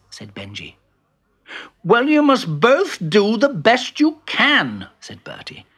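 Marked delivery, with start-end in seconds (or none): 1.8-4.9 high, slow insistence; bossy insisting. Acted. Bertie instructing Benji, high bossy insistence.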